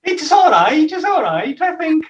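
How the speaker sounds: put-on high lilting Welsh accent; playful put-on Welsh lilt. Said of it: "Can we hear it?".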